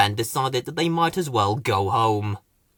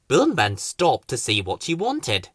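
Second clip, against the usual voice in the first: ordinary delivery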